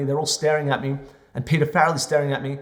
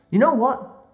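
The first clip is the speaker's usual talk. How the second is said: higher-pitched announcement of an idea; pitched-up imitation of a director; director calling out; 8 semitones higher than usual. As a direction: voice a director thinking aloud, pitched up. Acted director calling for a line.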